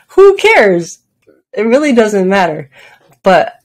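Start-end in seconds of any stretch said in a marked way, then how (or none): 0.1-1.0 high, louder burst through a laugh; real giggly exclamation; amused, laughing. Real, not acted. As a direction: laugh at your own silly habit. Laughs at own silly practice.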